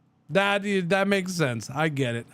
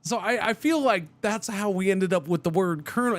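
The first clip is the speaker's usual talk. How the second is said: usual speaking voice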